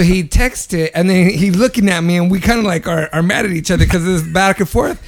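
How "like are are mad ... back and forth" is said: ordinary delivery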